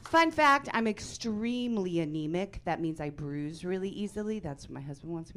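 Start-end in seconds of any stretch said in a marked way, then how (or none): none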